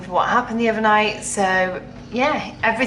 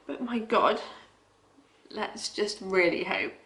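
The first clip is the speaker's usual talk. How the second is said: ordinary delivery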